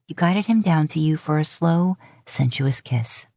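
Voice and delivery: usual speaking voice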